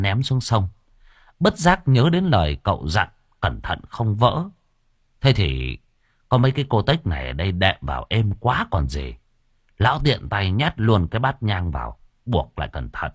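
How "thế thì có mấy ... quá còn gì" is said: plain reading voice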